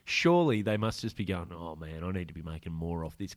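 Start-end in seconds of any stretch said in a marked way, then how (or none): 1.5-3.3 quiet grumble. Retold or imitated. Imagined grumbling newsagent.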